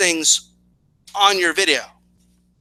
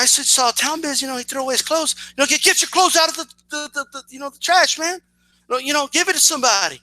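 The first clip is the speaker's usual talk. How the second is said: higher-pitched mock of an indignant caller-out; mocking YouTuber quote; 7 semitones higher than usual. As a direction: act out someone complaining, voice pitched up. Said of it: "and saying, "Hey".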